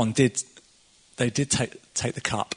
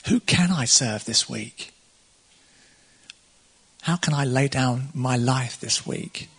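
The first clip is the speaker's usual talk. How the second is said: reflective prayer. Acted. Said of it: "So maybe just close your eyes. Just maybe just think".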